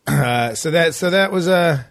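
normal talking voice

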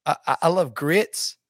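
hesitant, put-on Southern accent; phony Southern twang; fake Southern accent. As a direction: fake a folksy Southern accent, stumbling for words. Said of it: "just faking his Southern accent".

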